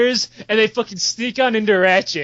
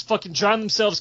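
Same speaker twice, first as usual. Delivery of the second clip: ordinary talk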